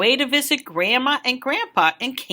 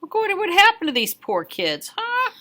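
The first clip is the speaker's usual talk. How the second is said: higher scold ending in a sharp huh; stern teacher; 7 semitones higher than usual. Acted. Recess teacher scolding the kid, higher and stern. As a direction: scold the snowball thrower. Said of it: "He said".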